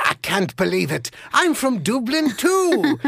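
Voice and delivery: rising to a thrilled Irish squeal; excited Irish accent. Retold or imitated. Second Irishman amazed they share a city, high excited brogue. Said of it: "identify with the accent".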